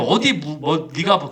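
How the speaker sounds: ordinary delivery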